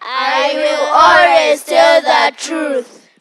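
choral repetition. Real, not acted. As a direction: chant it out loudly all together. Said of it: "Can we repeat it all together?".

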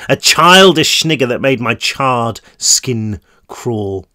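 usual speaking voice

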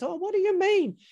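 imitating Nan's alarmed what; hammed-up surprised grandmother; startled Nan. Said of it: "And she goes".

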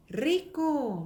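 "rico" with a strong initial trill; drawn-out trilled "rico"; rolled r. Shown as a demonstration. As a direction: roll into "rico" and stretch it. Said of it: "강하게".

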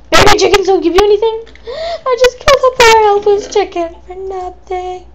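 loud, high gasp of dismay; hammed-up shock at a dead chicken; shocked outcry. Real, not acted. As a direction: gasp in horror at what you did. Horror at killing a chicken.

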